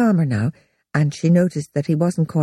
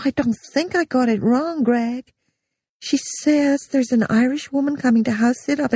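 higher, hurried backpedalling phone voice; backpedal, flustered and higher; flustered secretary; 8 semitones higher than usual. A narrator's reading. Heidi backtracking on the phone to Greg, flustered.

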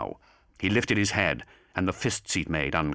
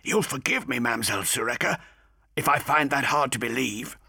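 higher, politely doubtful voice; sceptical Cairo; 7 semitones higher than usual. A narrator's reading.

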